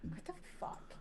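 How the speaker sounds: very soft, whispered disbelief; stunned whisper. Acted. Player reacting to unexpected banking information, stunned whisper. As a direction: whisper it in bafflement.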